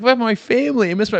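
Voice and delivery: high, loud hammed-up woman's plea; pleading woman. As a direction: whine it up high like a homesick woman. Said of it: "And she's like".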